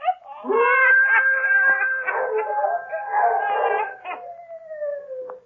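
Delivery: shrieking witch laugh; old Sadie's long, shrieking mad cackle. Acted. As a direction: cackle wildly on and on.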